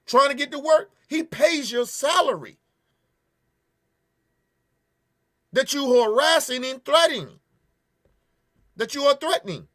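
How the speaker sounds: high, loud and outraged; furious shout. Real, not acted. Defending the brother on his way to work, shouting furiously. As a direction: yell it at the officer, fed up.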